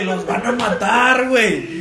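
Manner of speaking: high, loud, slow laughing urgency; urgent plea. Retold or imitated. Urging Tanque to open the gate, high and laughing. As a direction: laugh while pleading urgently. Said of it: "Le dije".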